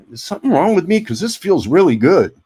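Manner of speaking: high, loud, hammed-up astonished wonder; playing up how shocked he was that it felt good; mock amazement. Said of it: "And I'm going like".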